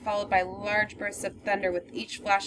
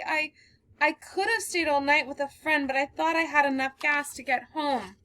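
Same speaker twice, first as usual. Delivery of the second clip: higher voice, trying to steady herself; shaken young woman; 5 semitones higher than usual. Acted. Girl recounting her night to the stranger, shaken. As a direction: pull yourself together and tell it.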